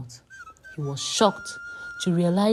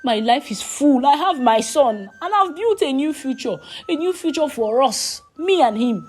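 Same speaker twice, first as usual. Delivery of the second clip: proud declaration; 8 semitones higher, 6 dB louder than usual. A narrator's reading. Ifeoma proudly declares her new life.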